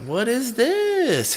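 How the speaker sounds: high and slow, stretched in wonder; amazed what is this. Retold or imitated. Hearing a new song on the radio, high amazed drawl. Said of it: "And I was like".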